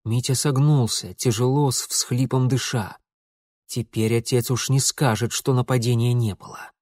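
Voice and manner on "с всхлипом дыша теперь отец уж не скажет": plain reading voice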